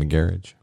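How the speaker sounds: "garage" said as GARE-idge; low "garage" rhyming with carriage; British-style garage. Shown as a demonstration. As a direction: stress the first syllable of "garage", ending -idge. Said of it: "Or you could even say garage".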